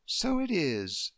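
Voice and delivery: slow, calm and gentle agreement. Acted. Deirdre calmly humouring Emily about the ship. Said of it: "Deirdre said calmly".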